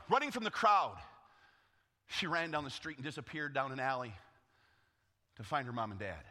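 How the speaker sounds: ordinary talk, nothing marked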